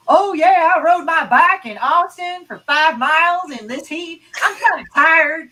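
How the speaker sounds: high, loud, drawn-out Appalachian twang; Appalachian accent. Retold or imitated. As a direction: imitate an Appalachian dude, slow and high. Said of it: "this dude from Appalachia".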